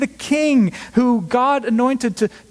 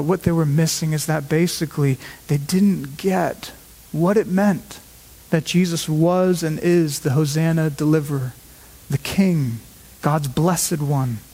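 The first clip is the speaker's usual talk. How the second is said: ordinary talk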